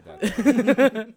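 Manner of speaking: laughing denial. Real, not acted.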